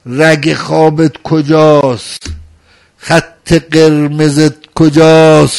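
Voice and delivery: slow, loud questions flung at the crowd; loud rhetorical challenge. Acted. Preacher challenging the mourners, slow and loud.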